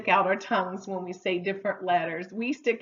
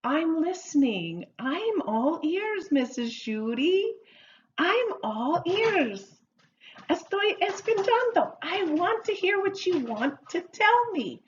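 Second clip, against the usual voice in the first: acted eager listener; 7 semitones higher, 3 dB louder than usual. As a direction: play someone keen to hear every word. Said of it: "No, it means".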